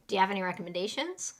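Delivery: quick, linked "Do you have any recommendations?"; fast natural English. Shown as a demonstration. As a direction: run "Do you have" together and keep moving briskly. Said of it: "we get into the fast English".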